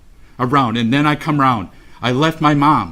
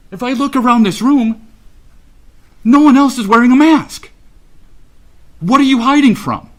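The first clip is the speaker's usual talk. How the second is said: real indignation, voice raised high and loud; angry confrontation; 9 semitones higher, 6 dB louder than usual. Real, not acted. Victim's parent confronting the defendant in court, angrily indignant. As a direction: challenge him sharply, loud and high-pitched.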